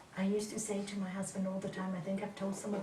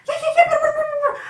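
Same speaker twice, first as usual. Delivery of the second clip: much higher, louder laughing babble; mock chatter; 17 dB louder, faster than usual. Mocking her own chatter to husband, high. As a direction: squeal out endless yakking. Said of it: "and I was talking".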